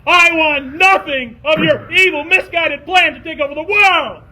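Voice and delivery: loud high heroic defiance; defiant declaration. Acted. Jason defying the Skunk King, loud heroic. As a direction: proclaim it boldly to the villain. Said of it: "I told the Skunk King".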